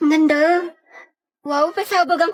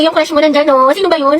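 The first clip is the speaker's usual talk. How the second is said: ordinary talk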